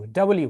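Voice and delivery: "ডাবল ইউ" said as two parts, double then U. Shown as a demonstration. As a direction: say "ডাবল ইউ" clearly, not "ডাব্লু". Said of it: "এটাকে কিন্তু আমরা actually বলবো ডাবল ইউ". ("ডাবল ইউ" দুই ভাগে স্পষ্ট করে বলা)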